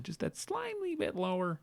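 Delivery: very high, small, soft voice; tiny squeaky voice. Pretend. Host mocking the slim gap with China, tiny high voice.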